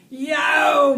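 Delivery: very loud, high startled yell; panicked shout. A narrator's reading. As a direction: shout out in sudden alarm. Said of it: "Marty shouted".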